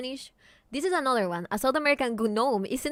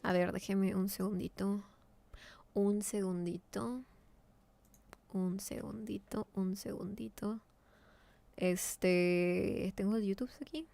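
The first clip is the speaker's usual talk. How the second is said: soft muttering; 7 dB quieter than usual. Real, not acted. Muttering while searching.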